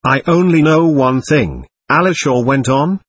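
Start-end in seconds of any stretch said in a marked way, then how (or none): none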